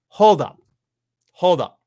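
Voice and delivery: sharp, loud double stop; loud hold up. Retold or imitated. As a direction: halt them hard with a loud hold up. Outraged reaction to wrestling news.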